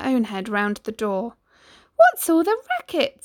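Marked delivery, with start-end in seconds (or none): 1.9-3.2 high, loud startled call; surprised cry. Acted. Cindy calling from the kitchen door, high startled cry. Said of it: "Cindy cried".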